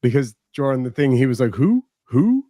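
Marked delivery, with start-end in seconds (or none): 1.5-2.4 mock owl hoots; imitating an owl. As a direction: hoot it like an owl. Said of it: "Are you a fucking owl?".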